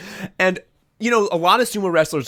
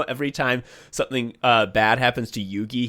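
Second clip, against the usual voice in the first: ordinary talk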